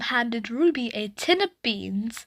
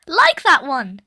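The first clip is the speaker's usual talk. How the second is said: put-on toddler echo; 3 semitones higher, 6 dB louder than usual. A narrator's reading. Ruby echoing.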